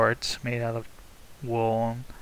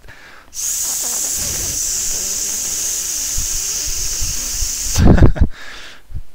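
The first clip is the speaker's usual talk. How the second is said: high wheezing laugh; 7 dB louder than usual. Real, not acted. Laughs at hitting the S key.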